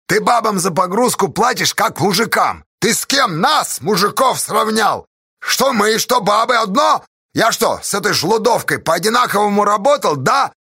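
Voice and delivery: high, outraged haranguing; indignant tirade. Acted. Pozdey protesting equal pay for women, indignant shouting. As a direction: throw out each question in loud protest.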